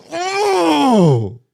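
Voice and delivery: a loud, high, startled cry; sudden yelp. Retold or imitated. Kid snapping at the teacher with a high yelp. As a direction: let out one sharp, high yelp. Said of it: "I went".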